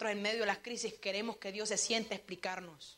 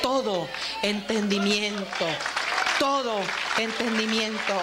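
loud, slow, weighty declaration; slow preacher's emphasis at full force; forceful preaching; 8 dB louder, slower than usual. Real, not acted. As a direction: drive each word home to the congregation. Repeats scripture phrase, building force.

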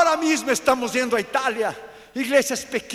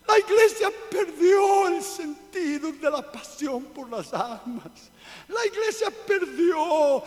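full-force preaching; 4 semitones higher, 5 dB louder than usual. Real, not acted. Preacher rebuking the church over lost passion, impassioned shout. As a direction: preach it with mounting force, hammering each phrase.

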